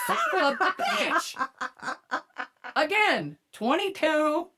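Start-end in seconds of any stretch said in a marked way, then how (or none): 0.1-1.5 slow, high, fed-up curse; exasperated curse. Retold or imitated. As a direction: drag out the curse in disbelief.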